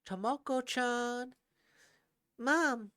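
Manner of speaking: high, loud call across the house. Acted. Son calls out to his mother, high and loud. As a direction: call to her from afar.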